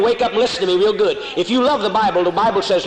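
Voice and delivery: usual speaking voice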